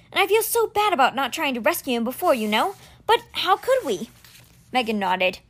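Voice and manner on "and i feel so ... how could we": put-on higher, worried boy voice tumbling out; words flooding out of a frightened boy; anxious boy Alex. Boy telling his friend everything that's going wrong, anxious.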